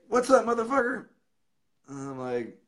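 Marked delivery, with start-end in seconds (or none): 0.1-1.1 very high, rowdy greeting. Retold or imitated. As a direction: whoop it down the phone at a buddy. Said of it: "He's like".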